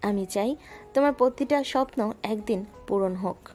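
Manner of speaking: ordinary delivery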